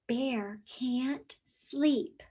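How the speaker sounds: slow soft whisper. Acted. Teacher whispering the ending, slow whisper. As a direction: breathe it out quietly. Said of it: "whispered story line".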